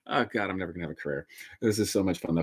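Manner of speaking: laughing at ruining his career; muttered aside. Real, not acted. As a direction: mumble it quickly to yourself. Joking aside after his rant.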